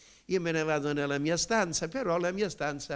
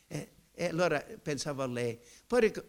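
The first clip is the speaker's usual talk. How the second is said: ordinary delivery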